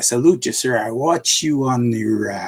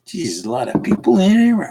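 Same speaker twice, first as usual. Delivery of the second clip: higher, amazed at the crowd; surprised exclamation; 8 semitones higher than usual. Real, not acted. Host amazed as viewers pile into his stream, surprised. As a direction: gasp it out, startled by all the people.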